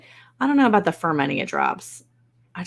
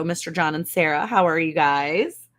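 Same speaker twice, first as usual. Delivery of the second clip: ordinary talk, nothing marked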